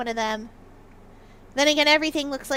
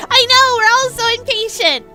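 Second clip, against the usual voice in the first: excited outburst; 9 semitones higher, 10 dB louder than usual. Real, not acted. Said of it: "excited complaint".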